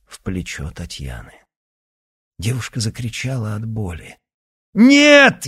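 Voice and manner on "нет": very high, loud cry of rage; furious shout. Acted. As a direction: scream it as you lunge. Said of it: "яростно рванулся Никита".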